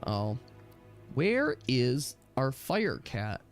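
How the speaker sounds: ordinary delivery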